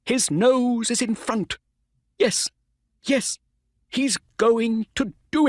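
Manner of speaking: high, loud urging at the finish; will the horse over the line; excited Mister Ross. A narrator's reading. Owner urging his horse toward the finish, high and loud.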